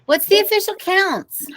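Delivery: put-on a girl shouting over a roaring cafeteria; shouting over noise. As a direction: yell the question over the din. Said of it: "Lindsay shouted to Dave".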